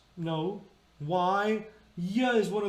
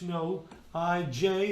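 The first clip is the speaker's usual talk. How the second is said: ordinary delivery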